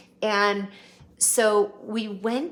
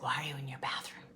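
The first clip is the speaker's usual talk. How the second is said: imitating [name]'s whisper; 4 semitones lower, 9 dB quieter, much faster than usual.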